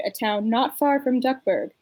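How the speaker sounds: normal talking voice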